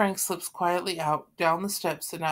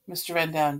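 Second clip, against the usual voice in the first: ordinary delivery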